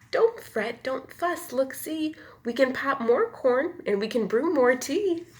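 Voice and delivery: high squeaky comforting little mouse. Acted. Mouse comforts the sad bear, high squeaky voice. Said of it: "Mouse squeaks".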